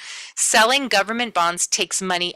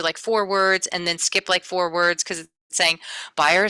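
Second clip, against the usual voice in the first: normal talking voice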